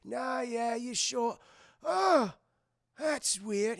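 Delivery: put-on high, doddery old rat voice; mock befuddled old pirate; ancient cabin boy. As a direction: muse slowly, puzzled, as an old sailor. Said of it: "He's like".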